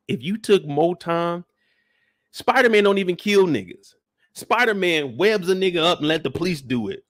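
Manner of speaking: ordinary delivery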